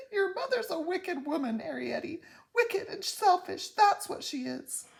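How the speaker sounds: high, tearful self-accusation; trembling voice. A narrator's reading. Mother calls herself wicked to her daughter, trembling and tearful. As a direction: let the voice shake with tears. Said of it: "Homily's voice began to tremble".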